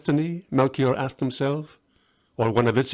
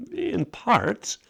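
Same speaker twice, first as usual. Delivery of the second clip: hesitant; 12 semitones higher than usual. Acted. Melchior squirming, evasive.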